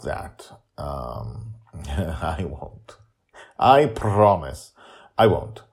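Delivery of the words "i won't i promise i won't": ordinary delivery, nothing marked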